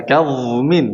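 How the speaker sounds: kazmin drawn out slowly, the z as a flowing dha; slow demo of kazmin. Shown as a demonstration. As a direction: spell out kazmin slowly for the student. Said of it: "coba gini".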